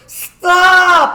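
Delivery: high, very loud drawn-out scream; imitated panicked friend's big holler; friend's panicked yell. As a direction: echo the halt command in panic. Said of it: "Tim is like".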